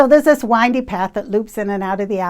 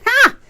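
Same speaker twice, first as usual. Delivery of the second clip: scoffing ha; 13 semitones higher, 5 dB louder than usual. Retold or imitated. Scoffing at the absurd request.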